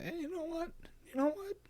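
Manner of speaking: very high squeaky retort. Pretend. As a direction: pitch way up, cartoonish. Retorting to imagined viewers.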